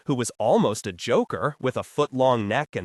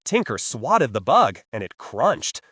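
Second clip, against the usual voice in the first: ordinary delivery, nothing marked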